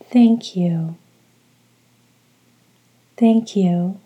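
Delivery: measured, evenly repeated meditation mantra; steady call-and-repeat chant, each phrase falling away; rhythmic mantra. Shown as a demonstration. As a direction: say it for listeners to echo, pausing after each. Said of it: "repeat each word after me following the rhythm".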